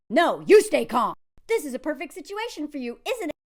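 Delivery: ordinary delivery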